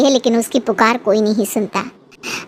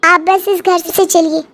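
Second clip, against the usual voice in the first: put-on defiant young daughter; 6 semitones higher than usual. Daughter defies her grandmother.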